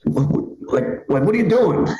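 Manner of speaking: high-pitched, stuttering mock disbelief; appalled stammer. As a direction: stumble over the words, then squeal the question. Appalled bystander confronts him over fart.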